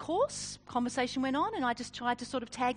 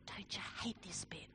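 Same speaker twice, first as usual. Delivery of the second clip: hushed conspiratorial whisper, much softer; 5 semitones lower, 14 dB quieter than usual. Retold or imitated. Woman at the dinner table whispering to the speaker. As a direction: lean in and whisper it behind your hand. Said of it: "leant over and whispered".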